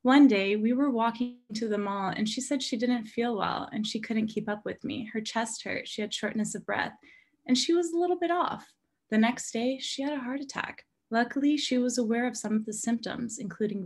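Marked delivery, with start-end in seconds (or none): none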